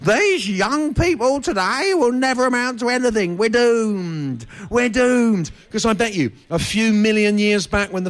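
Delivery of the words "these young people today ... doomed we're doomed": put-on high, huffy old British fogey; doom-laden grumble of a crusty old colonel; crotchety old Brit. Comic mimicking crusty post-Empire elders, huffy and high. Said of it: "but it's still pretty much".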